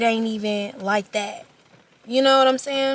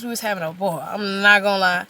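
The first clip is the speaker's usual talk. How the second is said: ordinary talk, nothing marked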